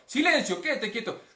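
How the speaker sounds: loud, high commanding shout; Jesús. Acted. Jesus calming the waves, commanding shout. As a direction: shout the order to be still. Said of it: "le pega el grito".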